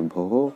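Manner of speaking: भोः said bho-ho with an echoed ho; visarga said as ho. Shown as a demonstration. Teacher showing students that भोः sounds bho-ho. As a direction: close भोः with a soft ho. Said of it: "ओ हो तो हो जैसा".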